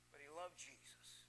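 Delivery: very soft, low delivery; tender, quiet payoff; hushed contrast. Real, not acted. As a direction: drop almost to a murmur. Sudden drop after shouted list.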